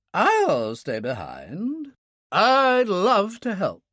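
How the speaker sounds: put-on slow, high, keen old woman; elderly lady insisting, warmly slow; old lady, eager. A narrator's reading. Elderly lady volunteers to stay behind, eager and slow.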